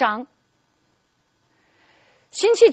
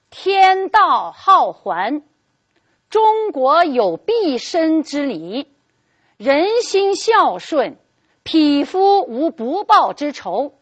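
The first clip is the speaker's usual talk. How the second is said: slow, solemn, louder word-by-word reading; drawn out royal decree; 2 semitones higher, 3 dB louder, slower than usual. Retold or imitated. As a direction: read it out word by word like an imperial edict. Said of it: "正一字一顿地念着". (一字一顿地宣读圣旨)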